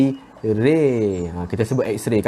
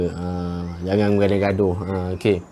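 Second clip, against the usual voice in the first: ordinary talk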